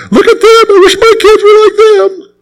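high, whiny voice of a jealous believer; preacher mocking a congregant envying another family; mock envious churchgoer. Mimics envious churchgoer again.

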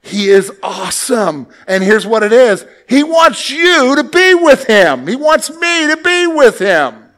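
loud, high, slow proclamation; full-force preaching. Real, not acted. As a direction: build to a soaring preaching peak. Preaching peak.

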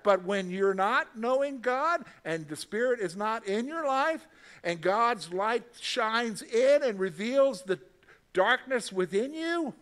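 ordinary talk, nothing marked

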